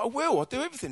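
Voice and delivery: put-on high, eager young voice; squeaky eager promise. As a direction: pledge it like a keen kid.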